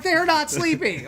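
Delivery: high, loud, drawn-out protest; exasperated mock shout. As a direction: stretch it out in loud disbelief. Teammate protests the obvious lie.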